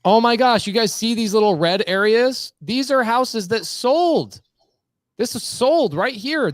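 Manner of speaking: yelling. Real, not acted. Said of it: "I've just been yelling the whole time".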